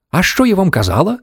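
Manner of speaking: put-on high-pitched old-lady outcry; mild cry of told-you-so; indignant exclamation. A narrator's reading. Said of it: "вигукнула бабуся Джозефіна".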